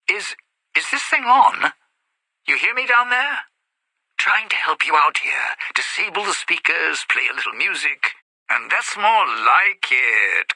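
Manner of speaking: loud voice through a loudspeaker; booming over an intercom; over the speakers. Acted.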